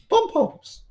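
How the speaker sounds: high mock cheer on "Pom-poms."; squeaky pom-poms. Teasing Elise about ringside cheering.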